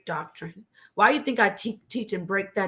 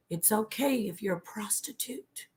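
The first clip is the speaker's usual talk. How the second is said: whispered quote; 8 dB quieter than usual. Retold or imitated. Quoting the cult's lesson to sisters.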